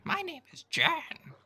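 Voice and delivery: tiny creature voice. Acted. Tiny creature Jan greets the traveler in a high squeak. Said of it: "I'm gonna stop this voice".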